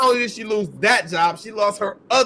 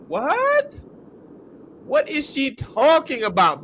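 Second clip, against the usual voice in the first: sharp, much higher squeak of disbelief; high disbelieving what; 5 semitones higher, slower than usual. Real, not acted. Host reacting to the teacher's excuse, incredulous high what. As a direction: let it jump up in pure disbelief.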